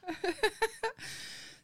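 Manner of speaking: high-pitched real giggle; high laugh. Real, not acted. Laughing after being called cheeky, high laugh. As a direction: giggle at the insult.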